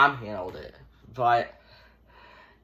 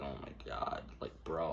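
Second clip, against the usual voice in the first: exasperated whisper; 11 semitones lower, 17 dB quieter than usual. Retold or imitated. Own exasperation retold.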